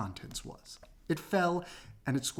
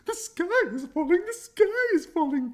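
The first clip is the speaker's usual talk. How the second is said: much higher panicked hen cry; clucking hen; 7 dB louder than usual. Acted. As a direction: squawk it out like a frantic chicken. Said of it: "clucked Chicken Licken".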